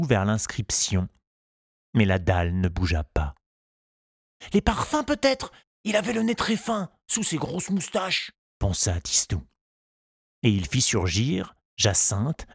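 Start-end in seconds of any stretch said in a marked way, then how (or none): 4.5-8.3 put-on high, light boy's voice; child voice. A narrator's reading. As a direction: voice a hopeful little boy. Tistou's thoughts voiced.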